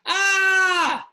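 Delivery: high, loud imitated scream of pain; father mimics his son's pained yell. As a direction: cry out suddenly and high like a hurt boy. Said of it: "and he yelled".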